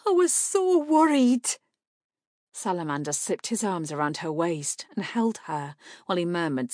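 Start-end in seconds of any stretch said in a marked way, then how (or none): 0.1-1.6 high, loud, quavering worry; tearful, raised-voice relief; on the edge of sobs. A narrator's reading. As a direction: let the worry spill out, nearly sobbing. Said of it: "Her voice quavered on the edge of sobs".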